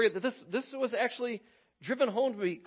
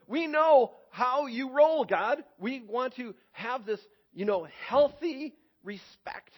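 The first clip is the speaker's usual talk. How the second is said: earnest Israelites; 6 semitones higher, 5 dB louder than usual. Retold or imitated. Israelites promising God.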